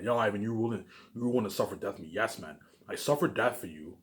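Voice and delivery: normal talking voice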